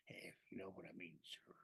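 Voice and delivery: sly pirate whisper. Acted. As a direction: breathe it as a dirty aside. Pirate innuendo aside.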